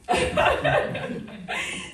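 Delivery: quick high laugh; amused laugh at the misspelled name. Real, not acted. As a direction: laugh at the mix-up.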